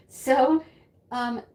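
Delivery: normal talking voice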